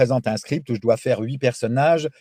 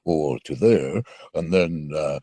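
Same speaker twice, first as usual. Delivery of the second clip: cartoon voice; slower than usual. Shown as a demonstration. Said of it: "un exemple de votre voix, lorsqu'il s'agit de cartoons". (voix de dessin animé qui passe des aigus aux graves, lentement)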